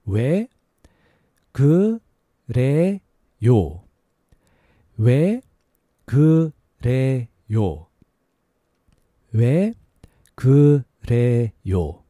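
slow, word by word; slow careful repetition. Shown as a demonstration. Teacher drilling learners slowly. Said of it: "A little faster".

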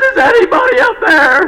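high, loud mock-desperate call; crying out desperately. Man hanging from cliff calls to God, crying out. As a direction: call up for rescue in comic panic. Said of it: "he cries out to God".

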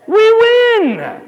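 bursting with joy; excited. Real, not acted. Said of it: "That's why I get excited".

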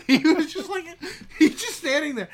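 high, loud laughing delivery; real laughter breaking through the words. Real, not acted. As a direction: tell it while cracking up. Cracks up telling the story.

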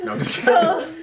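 high laughing retraction. Real, not acted. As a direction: laugh while taking it back. GM laughing after joke.

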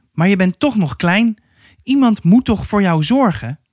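high-pitched, insistent woman character; concerned woman. A narrator's reading. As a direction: press the child in a lifted woman's voice. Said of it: "zei een vrouw".

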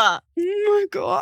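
slow, drawn-out disbelief; stunned. Real, not acted. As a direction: react as if you can't believe it. Reacting to a shocking moment.

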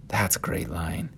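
soft, whispered appreciation; hushed aside. Real, not acted. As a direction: savour it under your breath.

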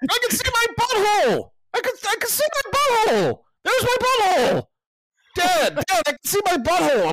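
put-on high, loud, thrilled little boy calling out; excited kid voice. Son shouting to dad from the bathroom, high excited kid voice. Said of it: "I hear Bubs in the bathroom going".

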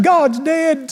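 high, mock-distraught wife; upset wife. Wife answering her husband on the couch, mock upset. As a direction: imitate a wailing woman, pitched high. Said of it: "She's upset".

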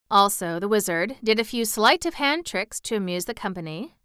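ordinary delivery

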